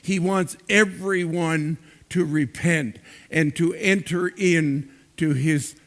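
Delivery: impassioned sermon delivery with swelling emphasis; preaching. Acted. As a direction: preach it to the congregation, rising on key words. Pastor preaching to congregation.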